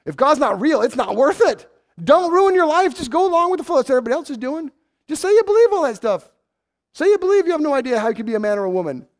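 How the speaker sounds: high, loud mocking tempter voice; mock the crowd's easy advice, loudly; voice of the world.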